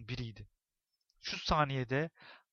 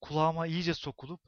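usual speaking voice

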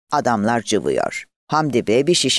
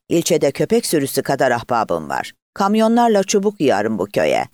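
usual speaking voice

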